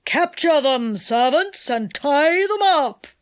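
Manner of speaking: forceful villain's command; commanding enchanter. A narrator's reading. Enchanter orders the imps to tie them up, commanding. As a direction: order your servants to attack.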